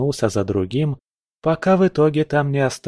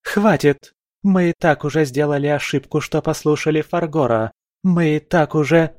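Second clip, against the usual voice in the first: higher, fast furious outburst; angry outburst; 5 semitones higher than usual. A narrator's reading. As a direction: cut them off in rage. Valkyrie bursts out angrily. (гневная вспышка)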